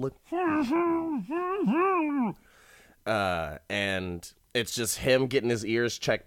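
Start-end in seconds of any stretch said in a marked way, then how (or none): 0.3-2.3 high, closed-mouth muffled whine; muffled noise imitation. Noise heard in his ear at doctor's office, muffled whine. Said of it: "kind of, like, a muffled".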